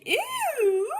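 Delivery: a very high, loud squealing ew; pig scream. Acted. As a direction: squeal it like a startled hog. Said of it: "who screamed".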